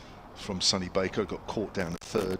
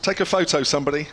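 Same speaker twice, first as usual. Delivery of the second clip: excited appeal; 6 semitones higher, 7 dB louder than usual. Real, not acted. Joking appeal about table position.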